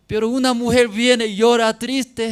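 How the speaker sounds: high, loud impassioned preaching; preaching at full force. Acted. Preacher pleading to the congregation, high and loud. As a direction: cry it out to the congregation.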